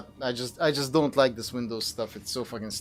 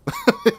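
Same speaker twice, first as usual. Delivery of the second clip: quick very high laugh; a giggle that escapes; short squeal of laughter; 4 dB louder than usual. Real, not acted. Laughing at the timing.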